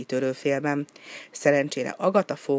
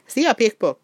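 higher, louder call from afar; 7 semitones higher, 5 dB louder than usual. A narrator's reading. Kópé calls to Pikpok from far away, a loud call. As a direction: call across the distance. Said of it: "kiáltotta messziről".

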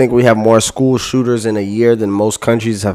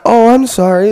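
mock apology; 12 semitones higher than usual. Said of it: "be acting like".

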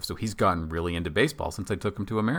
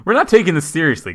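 loud mock announcement; 10 semitones higher, 10 dB louder, faster than usual.

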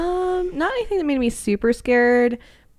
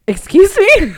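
shocked; 12 semitones higher, 9 dB louder than usual. Retold or imitated. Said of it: "we were like".

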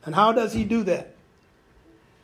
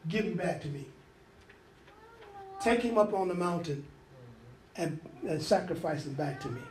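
much softer, hushed and solemn divine command; hushed voice of God; 5 semitones lower, 10 dB quieter than usual. Acted. Preacher voicing God asking Abraham for his son, hushed and grave. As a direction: lower the voice to a grave near-hush.

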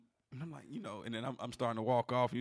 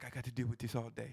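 lightly imitated East African accent, softer; put-on East African accent, understated; 6 dB quieter than usual. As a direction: quote a weary worker in an East African accent. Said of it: "Why you do the accent?".

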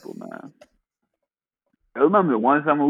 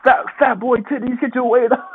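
mock trash talk; 10 semitones higher than usual.

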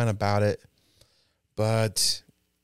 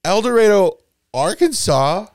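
El Dorado, Arkansas, loud and high; loud, raised, teasing correction of El Dorado, Arkansas; pointed correction; 6 semitones higher, 6 dB louder than usual. Shown as a demonstration. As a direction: hammer home El Dorado, Arkansas. Loudly corrects her slip.

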